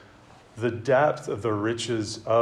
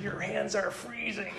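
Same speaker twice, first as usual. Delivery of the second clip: much higher, squeezed, breaking into laughter; laughing mock choke; 10 semitones higher, 8 dB quieter than usual. Preacher retelling the movie, playing Lloyd being choked, laughing.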